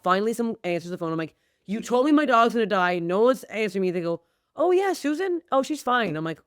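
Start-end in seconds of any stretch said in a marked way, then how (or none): 1.7-3.9 raised, higher, louder panicked complaint; frantic. Retold or imitated. Frantic call to the vet. 4.5-6.1 much higher, airy imitation of a cheery vet receptionist; breezy receptionist. Mimics the casual vet receptionist.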